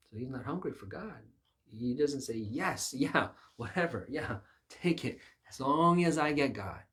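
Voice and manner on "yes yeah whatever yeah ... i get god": high, loud eager surrender. Retold or imitated. Preacher voicing one hungry for God, eager. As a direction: give it all up gladly, building louder. Acting the hungry seeker's reply.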